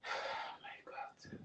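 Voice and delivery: breathless whispered aside; shocked whisper. Real, not acted. Host reacts to the insulting caller, whispering in shock. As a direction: whisper it in disbelief.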